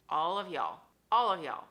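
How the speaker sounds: loud scolding, building to a near-yell. Real, not acted. Coach scolding her followers, loud and fed up. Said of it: "I'm angry right now".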